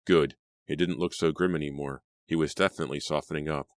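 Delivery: plain reading voice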